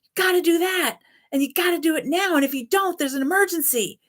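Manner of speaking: frantic inner alarm, pushing you to act now; panicked trauma voice. Acted. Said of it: "that's what trauma sounds like".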